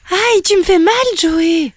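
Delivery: high, loud yelp of pain; pained cry. Acted. Mother kangaroo squeezed by her grown son, pained cry. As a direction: cry out as if hurt. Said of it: "s'écria la maman kangourou".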